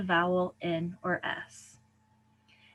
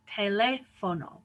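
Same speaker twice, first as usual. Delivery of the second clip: "teléfono" as te-LE-fo-no; third-to-last stress. Shown as a demonstration. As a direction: lean on LE in "teléfono". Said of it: "These emphasize the third to last syllable".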